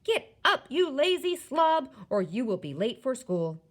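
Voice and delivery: loud, high scolding shout; exasperated dad yelling wake up; father's real voice. A narrator's reading. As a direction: rouse a lazy son at full volume. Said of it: "my father's real voice saying".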